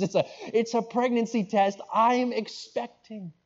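high, excited wife breaking the news; drawn-out, high-pitched big reveal; excited announcement. Acted. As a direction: burst out with joyful news, acted. Wife announces pregnancy to husband.

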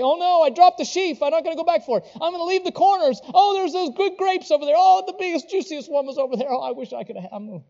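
hammed-up miser lamenting lost grapes; mock stingy voice. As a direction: ham up a greedy gleaner fretting over grapes. Mocking stingy gleaner voiced.

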